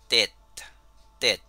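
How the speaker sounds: TET as a single word, open E and final T; pronuncia di TET. Shown as a demonstration. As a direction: demonstrate TET on its own, twice. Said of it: "se agrega la E".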